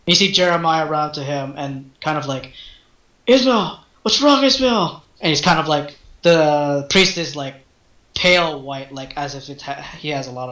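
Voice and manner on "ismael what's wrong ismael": high, urgent cry for a fallen friend; panicked call. Acted. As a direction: call out his name in alarm. Friend runs to collapsed priest.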